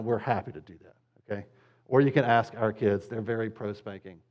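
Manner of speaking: ordinary talk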